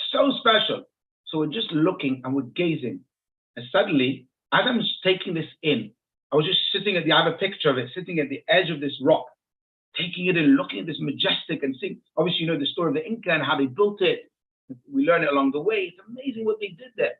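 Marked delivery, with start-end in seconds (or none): none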